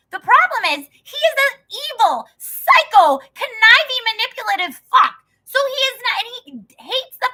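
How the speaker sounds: loud, high, spitting out each insult; furious rant. Real, not acted. Ranting to her viewers about Trump, furious shouting. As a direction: tear into him at full volume.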